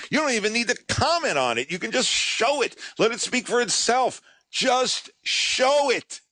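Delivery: high, eager, insistent pleading; urging him, nearly jumping; excited. Retold or imitated. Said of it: "I am all but jumping up and down".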